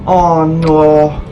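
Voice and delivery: normal talking voice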